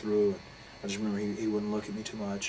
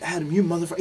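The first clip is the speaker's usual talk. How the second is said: loud, high-pitched yell of betrayal; furious friend cursing him out on the phone; retold angry outburst; 8 semitones higher, 8 dB louder than usual. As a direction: spit the name out like a curse. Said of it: "so upset and moved by the whole incident".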